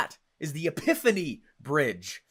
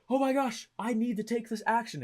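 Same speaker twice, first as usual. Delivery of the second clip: realization; 6 semitones higher than usual. Retold or imitated. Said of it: "that's what it causes".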